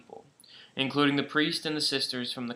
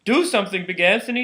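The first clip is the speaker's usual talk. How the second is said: higher, a little louder plea; shouting; 6 semitones higher, 4 dB louder, faster than usual. A narrator's reading. Townspeople shouting at Big Anthony to act. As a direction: beg him to fix it. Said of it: "they shouted".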